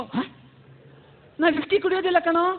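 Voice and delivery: ordinary talk, nothing marked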